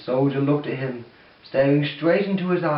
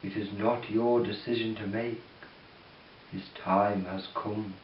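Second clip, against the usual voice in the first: cold voice of Death; 5 semitones lower, 8 dB quieter than usual. Acted. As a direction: speak like a cold wind. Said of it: "His voice was cold and flowed as if it were the wind".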